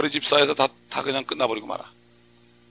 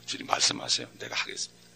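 hushed, much lower surrender; whispered prayer; 14 semitones lower, 7 dB quieter than usual. Pretend. Preacher whispering a believer's surrender to God.

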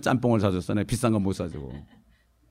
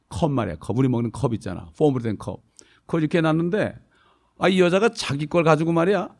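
ordinary delivery

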